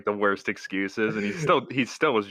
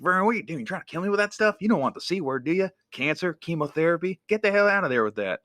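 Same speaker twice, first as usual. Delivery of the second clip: high-pitched, animated comic character rant; Jim Varney impression; 9 semitones higher, faster than usual. Comedian warning kids off smoking, imitated high character voice. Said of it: "And he's like".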